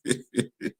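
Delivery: quiet, voiceless chuckle; short laugh. Real, not acted. Host dismissing appointed local government, short quiet laugh. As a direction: chuckle softly to yourself.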